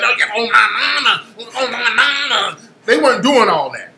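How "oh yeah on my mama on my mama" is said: mock hyped-up guy. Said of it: "They weren't all that".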